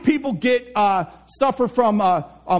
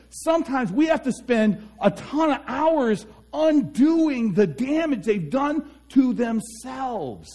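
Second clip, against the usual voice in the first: high, emphatic motivational preaching; impassioned preaching; 5 semitones higher than usual. Real, not acted. Preacher urging listeners to break the cage, impassioned. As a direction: drive it home to the crowd, pitched up. Urges listeners to stop bad self-talk.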